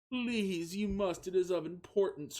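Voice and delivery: high, pleading whine; a hammed-up tearful plea, pitched high; whiny crying voice. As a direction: beg him like you're near tears. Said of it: "whined Katsumi with crying voice".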